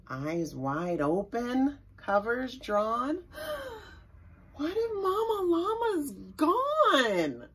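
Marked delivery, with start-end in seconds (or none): 3.2-3.8 sharp high frightened gasp; scared gasp. Acted. Scared baby in the dark. 4.5-7.5 high, worried little voice; fearful child. Acted. Baby fears mama's gone.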